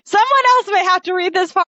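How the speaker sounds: high, tearful voice about to break; fighting back real tears as you speak; choked-up, teary plea to hand off the reading; on the verge of tears. Real, not acted. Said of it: "I'm gonna start crying".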